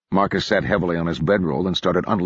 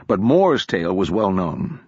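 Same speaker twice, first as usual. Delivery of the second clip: ordinary delivery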